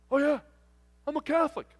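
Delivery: high, loud, confident answer; playful trucker voice; eager trucker character. Retold or imitated. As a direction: imitate a cheerfully sure respondent. Trucker answers survey brightly.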